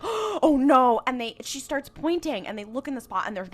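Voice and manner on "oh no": mock horror. Acted horrified reaction in retelling.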